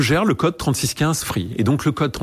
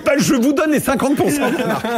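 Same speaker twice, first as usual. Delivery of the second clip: imitating the boss through laughter, pitched higher; laughing retold reply; 14 semitones higher than usual. Founder retelling the boss conceding the brand, laughing. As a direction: laugh while giving away the brand.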